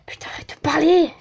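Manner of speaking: urgent hissed whisper. Real, not acted. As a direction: scold yourself in a whisper. Whispered self-scold. (chuchoté pressé)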